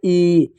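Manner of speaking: "ई" held long, a drawn-out "ee"; sustained "ई" for teaching; long vowel. Shown as a demonstration. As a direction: stretch "ई" out steadily. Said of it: "फिर आता है बड़ी ई".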